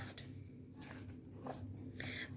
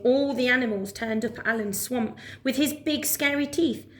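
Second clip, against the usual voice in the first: ordinary delivery, nothing marked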